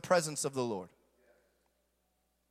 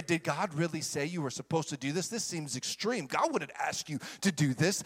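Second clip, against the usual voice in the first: softer, low insinuating murmur in the ear; mock devil whisper; 4 semitones lower, 6 dB quieter than usual. Devil murmuring doubts during the fast, softer. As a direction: slip doubts in quietly like the tempter. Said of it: "He's gonna whisper in your ear".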